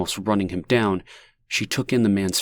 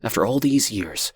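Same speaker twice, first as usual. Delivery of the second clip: plain reading voice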